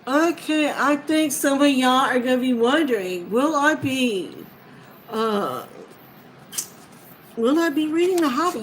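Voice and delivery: loud, high and bubbly, racing at the end; giddy unboxing energy; excited. Real, not acted. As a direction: burst out with the big question.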